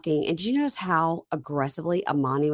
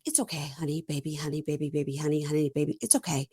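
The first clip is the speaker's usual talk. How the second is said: mock soothing; 3 semitones lower, 5 dB quieter, faster than usual. Smirking man soothing partner.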